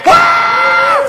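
high shouted "Ah!"; a sudden high cry of joy; ecstatic preacher's shout. Real, not acted.

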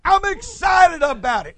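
high-pitched burst of excitement; excited exclamation. Acted. Preacher speaks as David signing his psalm, excited. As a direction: punch it out like an exclamation mark. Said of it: "signs it with an exclamation mark".

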